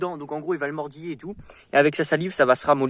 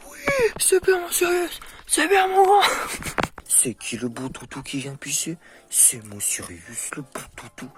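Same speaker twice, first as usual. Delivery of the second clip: talking to a dog; 2 semitones higher, 10 dB louder than usual. Real, not acted. Praising puppy after peeing.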